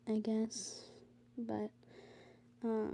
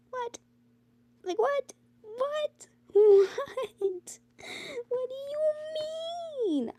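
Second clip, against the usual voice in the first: high squeaking what, half crying and laughing; incredulous shriek; 5 dB louder than usual. Real, not acted. A sick teen shrieks at the school board in disbelief.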